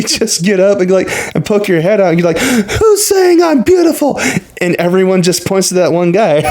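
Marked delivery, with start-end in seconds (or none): 2.4-4.2 high, playful calling out, pitched way up; a hint of a surprised celebrity calling over; mock surprised call. Vic poking head out, calling.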